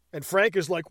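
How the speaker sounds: ordinary delivery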